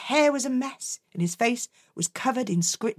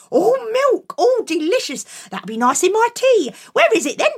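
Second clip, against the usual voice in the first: high, louder mum, excited; eager mum; 8 semitones higher, 4 dB louder than usual. Acted. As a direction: make her hungry for it. Mum excited about the milk.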